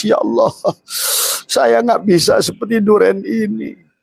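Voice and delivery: high, pleading cry to God; tearful prayer. Retold or imitated. Preacher voicing a weeping believer, high pleading prayer. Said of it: "harusnya nangis".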